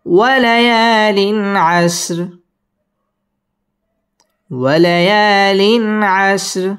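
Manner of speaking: chanted, light r, stop on আশর; recitation with pause. Shown as a demonstration. Teacher models the verse with a stop.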